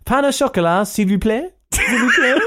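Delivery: high, hammed-up French accent; mock French waiter voice; exaggerated French accent. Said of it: "Should I do France?".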